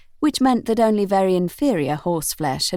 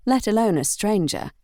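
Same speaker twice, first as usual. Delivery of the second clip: ordinary delivery, nothing marked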